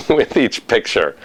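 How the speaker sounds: ordinary talk, nothing marked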